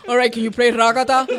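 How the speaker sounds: caller request. Retold or imitated. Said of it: "they're like".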